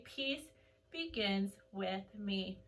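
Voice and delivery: soft, slow chant of the mantra. Shown as a demonstration. Teacher modelling the mantra for the class, soft and slow. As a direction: breathe it out calmly. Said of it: "and say".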